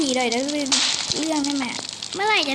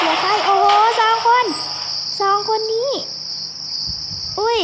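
excited; 7 semitones higher, 4 dB louder than usual. Real, not acted. Few players left, exclaiming.

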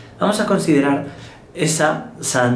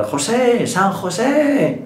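high, drawn-out shout for a friend; acted yell with the last word stretched long; calling out; 10 semitones higher, much slower than usual. José Mari calling San José from heaven's door, shouting. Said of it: "se pone a gritar".

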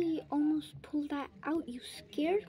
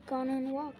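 ordinary delivery

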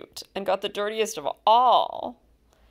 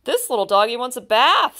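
put-on higher, louder little girl's voice; a bit of a delighted little girl; 5 semitones higher, 7 dB louder, faster than usual. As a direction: chirp it out like an excited small child. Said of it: "said the little girl".

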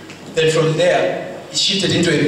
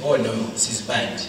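retold fear, much softer and lower; hushed dread; 9 semitones lower, 8 dB quieter than usual. Preacher retelling his fear in the vision, hushed. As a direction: breathe it like a frightened aside.

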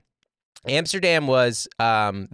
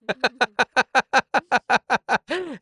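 high staccato giggle; long, high run of rapid laughter; amused laugh; 13 semitones higher than usual. Real, not acted. Laughs after retelling promoter's verdict.

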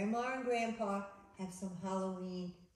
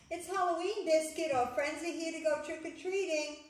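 put-on bright, higher read-aloud voice for kids; storybook reading; 7 semitones higher, 4 dB louder than usual. A narrator's reading. Librarian reading a Halloween book to children, lively. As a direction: read it to the little ones with wonder.

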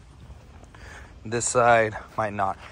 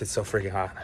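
winded, panting in the heat; out of breath; 4 semitones lower, 7 dB quieter than usual. Real, not acted. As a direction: speak through heavy breathing. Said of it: "I'm already out of breath".

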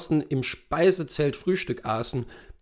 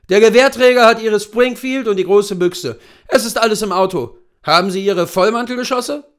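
higher, louder voice for the seasoned hunter; brief the client as an old hunting guide; erfahrener Großwildjäger; 8 semitones higher, 9 dB louder than usual. Acted. Hunter organising departure. (erfahrener Jagdführer, lauter und höher)

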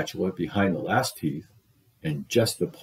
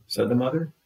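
ordinary delivery, nothing marked

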